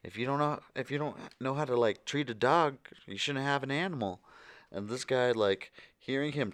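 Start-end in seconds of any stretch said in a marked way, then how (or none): 0.0-4.2 imitated scolding. Couple scolding the dog owner.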